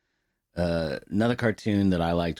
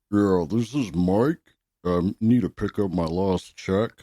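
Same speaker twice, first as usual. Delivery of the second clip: slow, put-on coworker voice, a little louder; posing as dead coworker; 2 semitones higher, 5 dB louder than usual. Prank call to the store manager, slow put-on coworker voice. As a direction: prank caller faking the dead man's voice. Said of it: "we'll say his name is Mike".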